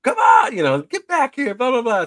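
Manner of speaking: high, loud imitation of fretting parents; calling kids back. Parents shouting after running kids, urgent call. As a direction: holler it after runaway children.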